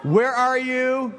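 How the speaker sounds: urgent worry, drawn out; father hearing of the crash; alarmed dad. Retold or imitated. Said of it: "dad alarmed on phone".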